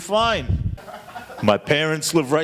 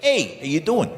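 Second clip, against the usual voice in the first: tough New York greeting, imitated; mock New York accent, punched "Hey". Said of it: "in New York, they're like".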